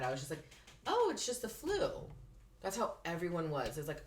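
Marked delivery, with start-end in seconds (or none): 0.9-1.9 high, airy mock brush-off; mock dismissive. To a friend, mocking her old self, high careless brush-off. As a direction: toss it off like it's nothing, hammed-up. Retelling her naive covid attitude.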